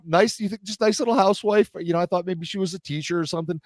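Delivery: normal talking voice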